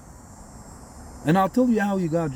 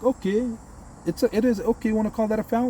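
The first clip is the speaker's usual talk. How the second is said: much higher-pitched, exaggerated okay; mock concession; 8 semitones higher than usual. Fan answering pedantic critics of the call, mock conceding. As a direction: concede in a high, pointed voice.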